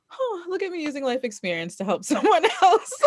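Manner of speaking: high, mock-proud laughing; self-mocking laugh. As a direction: brag at yourself through laughter.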